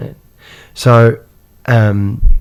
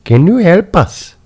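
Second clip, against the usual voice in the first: put-on high, pleading Indian accent; a little brother's Indian accent, pleading; 9 semitones higher, 4 dB louder than usual. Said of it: "he had a perfect Indian accent".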